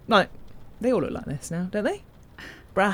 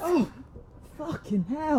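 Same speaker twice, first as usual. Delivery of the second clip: startled; 5 semitones higher, slower than usual. Acted. Startled by sudden voice.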